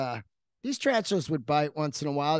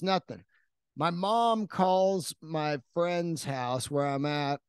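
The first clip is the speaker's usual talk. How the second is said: usual speaking voice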